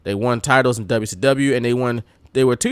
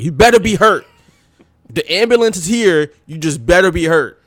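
louder, stern scolding; 3 semitones higher, 3 dB louder than usual. Retold or imitated. Rick Steiner scolding Buff like a stern mom, mock. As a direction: threaten like a fed-up parent. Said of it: "That's what Rick Steiner sounded like".

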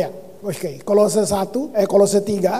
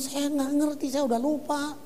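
quoting himself; 3 semitones higher, 7 dB quieter than usual. Retold or imitated.

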